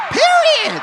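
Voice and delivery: very high, loud exclamation; excited shout. Real, not acted. Guest hyping his hometown, high excited shout. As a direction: punch it out like a cheer.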